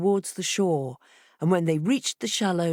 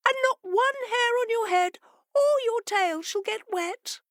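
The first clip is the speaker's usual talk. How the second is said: much higher, eager fish voice; fishes replying. A narrator's reading. Fishes promising the fox a dry ride, high and eager. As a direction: squeak it out reassuringly.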